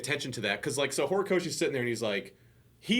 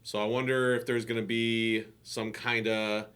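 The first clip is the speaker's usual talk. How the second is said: ordinary delivery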